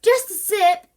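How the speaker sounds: high, loud pushy plea; squabbling. A narrator's reading. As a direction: push the drink on her. Siblings quarrel over lemonade.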